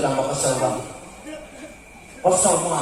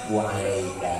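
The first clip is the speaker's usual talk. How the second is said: calm reply; 7 semitones lower, 5 dB quieter than usual. Retold or imitated.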